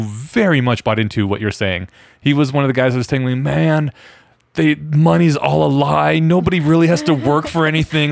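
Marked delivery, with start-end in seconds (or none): 3.4-8.1 laid-back stoner dude, slightly higher. Retold or imitated. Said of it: "I'm doing the stoner voice".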